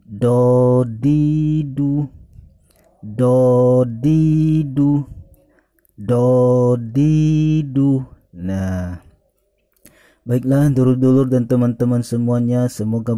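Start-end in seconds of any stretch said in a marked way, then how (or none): 0.0-8.0 slow, drawn-out syllables do-di-du; dodidu, each syllable stretched and clear; over enunciating. Shown as a demonstration.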